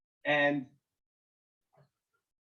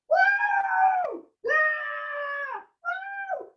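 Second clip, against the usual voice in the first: imitated crowd of cheering youngsters, very high; long shrieked "woo" and "yeah"; high cheering whoops; much slower than usual. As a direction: whoop like a wild back-of-the-bus crowd. Imitating young people cheering on bus.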